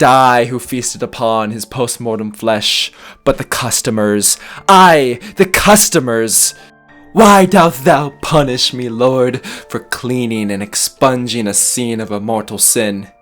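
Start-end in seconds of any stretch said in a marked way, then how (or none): 4.7-9.4 louder, higher repeat in horror; anguished exclamation. Acted. Murderer admits who ate the body, anguished. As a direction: cry out the awful truth.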